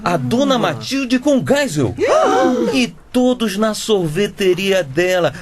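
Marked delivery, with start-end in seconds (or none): 2.0-2.7 short high gasp of shock; startled high intake of breath; sharp gasp. Acted. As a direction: gasp at the photo. Gasp at Geisel photo.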